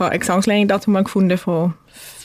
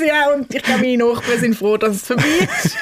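laughing; 4 semitones higher than usual. Real, not acted. Joking about neighbours, laughing.

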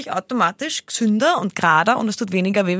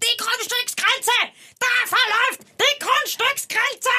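much higher, slow, screeching mock mum; screaming Lois; 14 semitones higher, slower than usual. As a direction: screech the boundary like the angry sitcom mother. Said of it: "Und sie schreit". (kreischende Lois-Parodie, viel höher und langsam)

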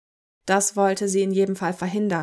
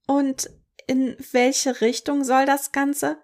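timid; 5 semitones higher, slower than usual. A narrator's reading. Clerk asks nervously.

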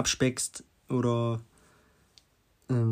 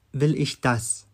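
closing question that lands on "das"; stress on das; slower than usual. Shown as a demonstration. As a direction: keep "Will ich" steady and weight "das". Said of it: "mit drei unterschiedlichen Betonungen".

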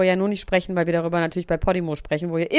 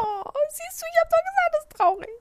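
high mock whine; 3 dB quieter than usual. Real, not acted. Mock sad triumph.

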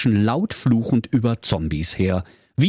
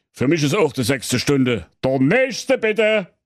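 bored king; 4 semitones higher than usual. Acted. King calls next suitor.

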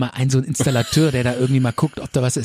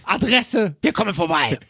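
brisk dispatcher; 6 semitones higher than usual. Retold or imitated. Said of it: "Alles klar".

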